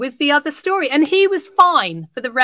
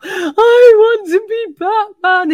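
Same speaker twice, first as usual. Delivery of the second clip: put-on high, slow, tearful wail of a sobbing boy; crying child; 8 semitones higher, 6 dB louder, slower than usual. Teacher voicing a crying boy who wants to be Batman, tearful. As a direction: voice the little boy begging through tears.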